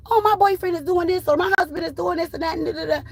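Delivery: high-pitched voice of an advice seeker; imitated young lady. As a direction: mimic a young woman reciting relationship troubles. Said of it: "when I talk to a young lady".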